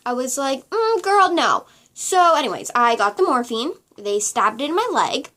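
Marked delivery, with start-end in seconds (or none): 0.7-1.7 sassy refusal. Retold or imitated. Refusing morphine, retold.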